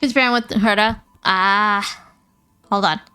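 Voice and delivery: ordinary talk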